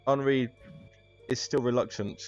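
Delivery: ordinary talk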